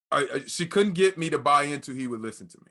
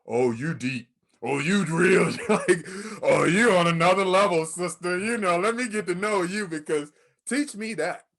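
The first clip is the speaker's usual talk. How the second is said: hyped-up praise; 4 semitones higher, 4 dB louder than usual. Retold or imitated. Hyping up a friend, imitated.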